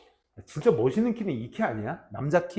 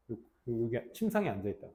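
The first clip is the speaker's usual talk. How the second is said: ordinary delivery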